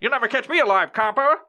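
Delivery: put-on high laughing old-movie gangster taunt; mock gangster. Fleeing suspect taunts police, hammed-up gangster. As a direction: taunt the copper like a cartoon crook.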